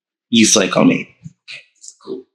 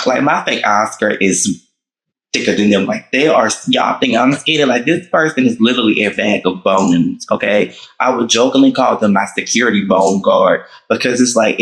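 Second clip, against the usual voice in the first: ordinary talk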